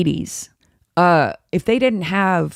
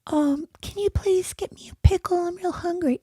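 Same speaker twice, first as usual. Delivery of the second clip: put-on much higher, softer childish whine; baby voice; 12 semitones higher, 6 dB quieter than usual. Shown as a demonstration. Mocking women who ask for pickles in a high soft baby voice. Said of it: "Or if you say stuff like".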